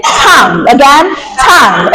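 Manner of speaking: usual speaking voice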